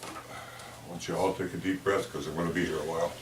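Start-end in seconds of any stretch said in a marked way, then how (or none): none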